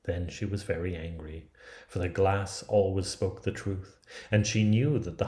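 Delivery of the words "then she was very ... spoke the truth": ordinary delivery, nothing marked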